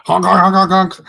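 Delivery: high honking goose voice; goose honks. Acted. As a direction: honk like an angry goose. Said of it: "devolves into honks".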